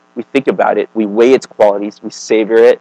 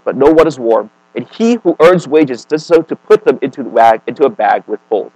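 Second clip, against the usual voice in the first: scripture reading; 3 semitones higher, 5 dB louder than usual. Acted. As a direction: proclaim the verses to the congregation. Reads scripture aloud.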